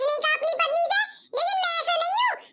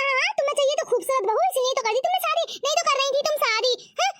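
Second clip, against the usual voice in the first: ordinary delivery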